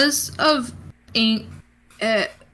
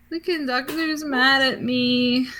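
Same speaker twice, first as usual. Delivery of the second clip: put-on hurt little voice; upset whining; 4 semitones higher than usual. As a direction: whimper it like a child who's in trouble. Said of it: "Benny's upset. He's like".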